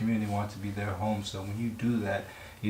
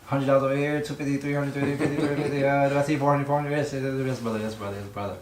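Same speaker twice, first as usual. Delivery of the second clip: auctioneer; 4 semitones higher than usual. Pretend. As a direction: rattle off the bids like a mosque auctioneer. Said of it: "It's almost like an auctioneer".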